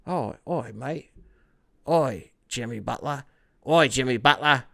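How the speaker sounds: hammed-up Australian accent; badly faked Australian accent. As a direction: put on a broad Australian "oi, mate". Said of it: "an Australian accent?".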